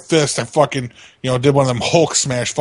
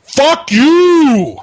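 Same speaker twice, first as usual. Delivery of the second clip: loud, high yell; shout on request; 14 semitones higher, 8 dB louder than usual. Shown as a demonstration. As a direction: scream it to prove you can. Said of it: "Prove it, mate".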